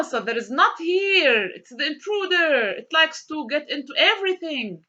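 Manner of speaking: ordinary talk, nothing marked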